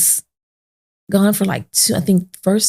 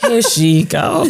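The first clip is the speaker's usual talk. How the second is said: laughing eye-roll; slower than usual. Retold or imitated. Dad rolls eyes at mom.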